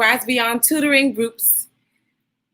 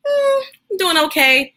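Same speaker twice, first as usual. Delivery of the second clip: wobbly, higher, half-crying "doing okay"; 8 semitones higher than usual. Shown as a demonstration. Host showing viewers a just-okay face, mock teary. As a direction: act out feeling just okay, a little tearful. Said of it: "kind of face".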